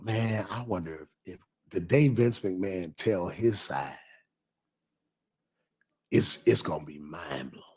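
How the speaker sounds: hushed to a whisper by the end; lowering the voice as if sharing a secret; mind-blowing hush. Retold or imitated. Recalling his own thought, dropping to a whisper.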